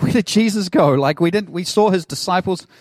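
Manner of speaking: mild mock freak-out; mock panic. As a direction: fret like people who lost him. Crowd freaking out, retold.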